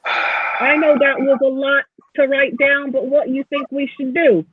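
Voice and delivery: halfling planner turning to the team for ideas; Joy. Acted. Joy asks party for opinion.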